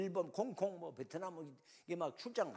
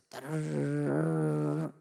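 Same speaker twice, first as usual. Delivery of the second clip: imitated phone ring; 8 dB louder, much slower than usual.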